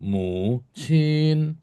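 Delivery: slow MU, then sustained high CHIN; first tone high. Shown as a demonstration. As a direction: hold CHIN up high after a dipping MU. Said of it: "pokoknya tinggi".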